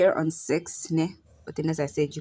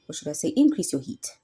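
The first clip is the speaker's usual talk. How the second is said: ordinary delivery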